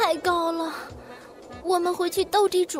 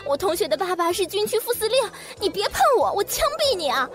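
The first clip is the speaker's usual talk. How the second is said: high, wailing tantrum; crying scream. A narrator's reading. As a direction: scream it out in tears. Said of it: "何木子哇地哭了，喊". (哭喊)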